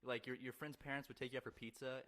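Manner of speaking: ordinary talk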